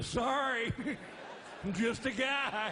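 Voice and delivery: high, loud and slow through laughter; laughing apology. Retold or imitated. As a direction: laugh it off as just a guy. Joking back at wife.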